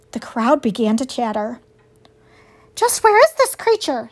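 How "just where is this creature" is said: high, sly fox; demanding fox, pitched up. A narrator's reading. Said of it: "said Fink the fox".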